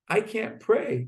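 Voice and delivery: high-pitched imitation of a tearful complaint; mock tearful. Zusha laments to his brother in the jail cell, mock tearful. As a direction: plead it, lightly mimicking tears. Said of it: "And he starts to cry".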